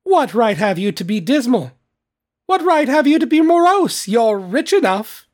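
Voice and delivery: high, loud, playful retort; gaily. A narrator's reading. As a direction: fire it back merrily, rising to a high peak. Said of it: "returned the nephew gaily".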